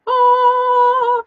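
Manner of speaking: loud, high held "oh"; sung "oh". Real, not acted. Host opening her live broadcast, high sung "oh". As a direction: sing out one long high note.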